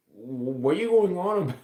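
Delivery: ordinary talk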